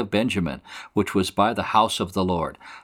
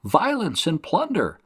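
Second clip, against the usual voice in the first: high-pitched acted cry of warning; mock shout; 8 semitones higher than usual. Prophet recalling his warning to the people, mock shout. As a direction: cry it out high, without real volume. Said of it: "I shouted".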